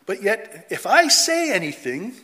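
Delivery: ordinary talk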